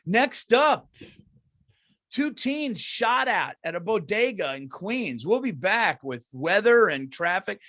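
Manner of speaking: slow, high mock news read; news anchor parody. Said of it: "like fucking Dateline".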